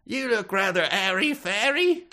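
high-pitched hammed-up British voice; mock British local. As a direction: tease in a put-on British falsetto. Acting a local mocking in accent.

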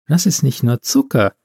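ordinary delivery, nothing marked

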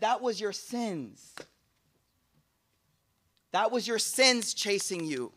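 slow, weighty delivery; slow solemn telling. Acted. Daughter telling her father what the snake was, slow and solemn. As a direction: lay each word down gravely.

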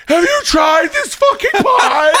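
high, drawn-out shriek across the house; laughing, over-the-top high shout; excited shout. Retold or imitated. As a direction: yell it high and loud, stretched out. Shouting at wife from stairs.

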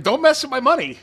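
defiant player. Retold or imitated. Voicing players in a retold story.

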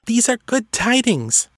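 very high little elf voice; insistent female elf. A narrator's reading. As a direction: pitch it right up, insisting the news is good. Female elf insisting to doubting companion.

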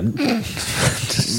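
a sudden high breathy burst of laughter; high airy laugh. Real, not acted. Laughing at his co-host's Irish claim, high airy laugh.

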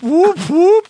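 high, loud whooping signal; siren-like whoop. Shown as a demonstration. Host signals a winning answer to players, whooping. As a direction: whoop it out like a game alert. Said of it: "you know what that sound means".

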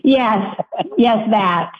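normal talking voice

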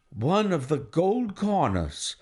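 ordinary delivery, nothing marked

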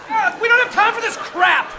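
snapping, fed up and loud; angry outburst. Acted. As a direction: shout it out in frustration. Urgent report of missing mayor.

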